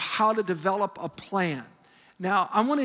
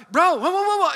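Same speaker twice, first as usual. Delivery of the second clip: retold panic from the passenger seat, higher and louder; nervous yelp; 9 semitones higher, 8 dB louder than usual. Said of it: "I get a little nervous".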